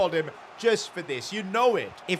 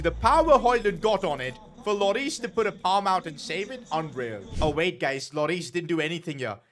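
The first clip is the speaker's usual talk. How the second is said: normal talking voice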